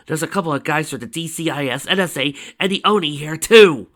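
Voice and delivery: loud rant, building at the end; exasperated rant. A narrator's reading. Agent fed up with rival agencies, exasperated rant.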